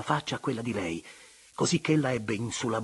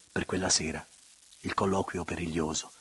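ordinary delivery, nothing marked

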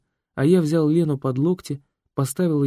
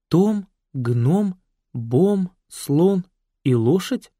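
names read with rising puzzlement; puzzled question; 6 semitones higher, much slower than usual. Acted. Hostess reads the back of the photo, puzzled. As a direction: ask each name as if baffled.

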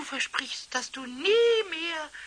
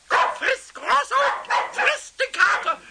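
put-on drunken urging; 7 semitones higher, 4 dB louder than usual. Drunk Pumuckl urging the dog.

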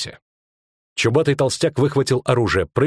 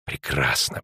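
low, hushed hiss; secretive, very low and soft; 9 semitones lower, 5 dB quieter, slower than usual. Acted. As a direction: hiss approval under your breath. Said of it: "прошипел Кайли".

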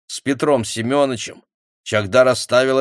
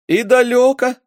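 faltering voice; 7 dB louder than usual. A narrator's reading. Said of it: "упавшим голосом спросила женщина".

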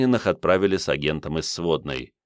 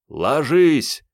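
louder, higher shouted command; shouting; 8 semitones higher, 5 dB louder than usual. A narrator's reading. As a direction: bark the order to drop. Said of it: "Майор-десантник заорал".

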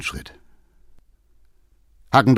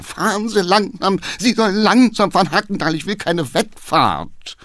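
shouting at the driver; 9 semitones higher than usual. Acted. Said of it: "schrie der Geheimrat".

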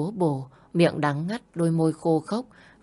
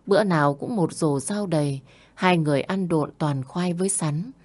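ordinary delivery

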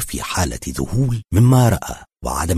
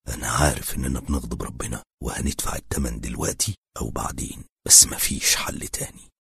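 softer, lower thought to himself; inner voice; 5 semitones lower, 6 dB quieter than usual. A narrator's reading. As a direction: say it to yourself, hushed. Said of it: "وقال في سره". (بصوت خافت كأنه بيكلم نفسه)

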